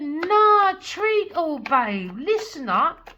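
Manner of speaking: high, loud swagger; bold king voice. Acted. King voiced through the reader, loud and high. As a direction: boss them, loud and high. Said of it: "He said".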